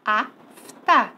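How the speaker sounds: AF, pause, TA; teacher splitting Afta into two separate syllables; divided into syllables. Shown as a demonstration. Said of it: "Vamos dividir?".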